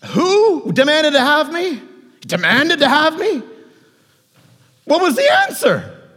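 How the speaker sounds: high, loud, incredulous outburst; alarmed disbelief. Acted. Peter reacts to Jesus's warning, loud high alarm.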